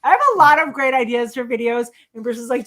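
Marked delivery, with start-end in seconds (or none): none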